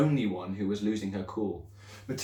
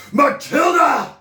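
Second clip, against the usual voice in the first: much higher, louder, slow roared name; bark; 14 dB louder, much slower than usual. Acted. Headmistress barking the pupil's name in fury. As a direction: roar the name like a command. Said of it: "she barked".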